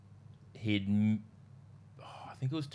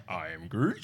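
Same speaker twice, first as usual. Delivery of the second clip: "I am Groot" rising at the end like a question; questioning inflection; 3 semitones lower, slower than usual. Retold or imitated. Showing that Groot inflects, questioning rise on "Groot". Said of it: "has some inflection in his voice".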